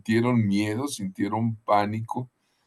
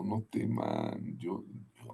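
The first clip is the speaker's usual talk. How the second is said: reassuring; 3 semitones lower, 12 dB quieter than usual. Acted. Jesus calming frightened disciples.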